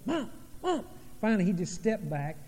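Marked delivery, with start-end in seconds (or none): 0.1-0.8 high, small mock kid calls; child seeking attention. Little boy interrupting his mom's conversation, high-pitched imitated pestering.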